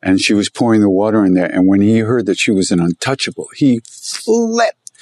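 ordinary talk

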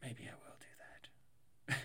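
slow breathy whisper aside. Real, not acted. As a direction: whisper it to yourself, slowly.

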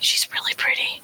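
quick voiceless aside; quick whispered aside to a friend. Acted. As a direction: lean in and whisper it fast. Player whispers aside to Dallas.

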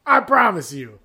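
high, loud southern refusal; mock southern pitchman. Marketer refusing the drunk gambler at the door, loud southern accent. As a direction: turn him down firmly in a southern accent.

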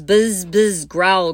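loud buzzing then growling. Shown as a demonstration. Teacher modelling the story's sound pattern, loud buzz and growl. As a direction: make the bee buzz, then the bear growl. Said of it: "We've got loud and soft".

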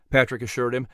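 plain reading voice

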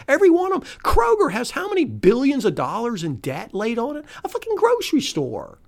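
high-pitched, indignant tirade; exasperated rant. Real, not acted. Host rails at stores requiring masks, exasperated rant. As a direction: fire off each complaint high, fed up. Ranting about stores with mask rules.

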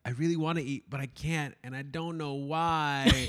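high, dragged-out mock distress; hammed-up plaintive complaint; putting on the drama. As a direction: whine it theatrically, stretching the ending. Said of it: "putting on the drama".

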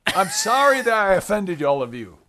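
fake apology. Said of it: "Here's their apology".

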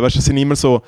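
usual speaking voice

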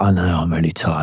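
lazily agree, a hint of a tired bloke; mock tired man. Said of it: "And they're like".

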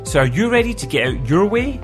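ordinary delivery

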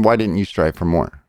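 ordinary talk, nothing marked

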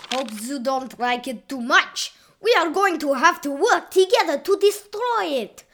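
loud, urgent Italian waiter; rally them in a thick Italian accent. A narrator's reading. Waiter urging the crew to fight together, urgent Italian accent.

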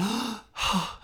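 loud high shocked gasp; sharp gasp of alarm; child's gasp. Retold or imitated. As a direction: gasp like a startled kid. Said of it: "And he went".

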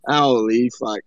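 deep, low drawled Machi-style holy fuck; imitating Machi. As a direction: drop the voice way down and growl out holy fuck like a friend. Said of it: "I've been hanging around Machi too long".

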